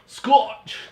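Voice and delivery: "Scotch!" barked high and loud in a mock Scottish voice; mock Scottish shout. Shown as a demonstration. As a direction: belt out "Scotch!" like a Scotsman. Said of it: "What's that accent".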